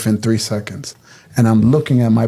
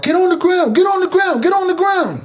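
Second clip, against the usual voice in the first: high-pitched, rapid-fire police commands; agentes gritando órdenes. Retold or imitated. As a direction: reenact agents yelling orders over and over. Agents storm in yelling.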